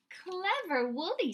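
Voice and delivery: high, slow admiring praise; boy proud of the prize-winning sheep; delighted praise. Acted. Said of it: "said Sam".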